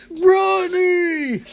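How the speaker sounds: a long, high wail of Ronnie; anguished cry. Acted. Caitlin screams for Ronnie as the door locks, wailing. Caitlin cries for her boyfriend.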